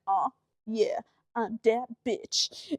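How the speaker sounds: low, soft self-satisfied brag; quietly gloating over the win; smug mutter. Retold or imitated. Bragging after spraying the spider off. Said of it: "and I was like".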